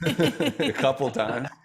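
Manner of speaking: laughing through the words, slow. Real, not acted. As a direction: chuckle it out, amused by the joke. Host laughs at guest's joke.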